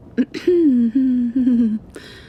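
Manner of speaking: a loud, deliberate throat clear. Real, not acted. Throat clear before asking her girlfriend a serious question. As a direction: clear the throat to get ready to speak.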